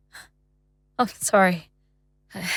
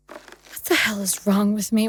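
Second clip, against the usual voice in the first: ordinary delivery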